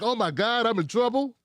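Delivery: mock panicked apology. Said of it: "she apologized like".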